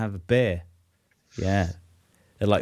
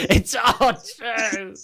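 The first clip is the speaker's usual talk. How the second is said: slow, laughing and high; laughing drawn out; 9 semitones higher, much slower than usual. Shown as a demonstration. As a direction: laugh through it slowly.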